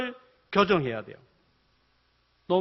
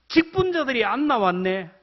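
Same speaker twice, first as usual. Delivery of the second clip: complaining; 4 semitones higher, 4 dB louder than usual. Shown as a demonstration. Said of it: "관점을 다르게 보면 감사하는 거예요".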